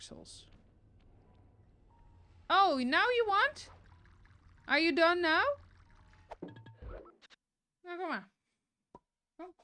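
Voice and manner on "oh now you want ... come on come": high, loud teasing at the dog; pet talk. Real, not acted. Owner teases the dog, high and loud. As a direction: scold the pet playfully, up high.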